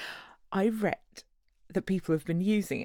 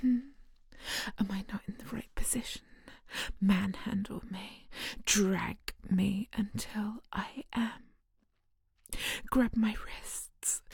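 seductive whisper; 6 dB quieter than usual. Acted. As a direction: whisper it close and tempting. Seductive whispered hypnosis continues.

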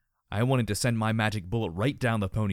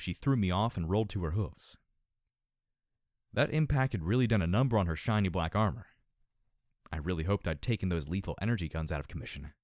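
usual speaking voice

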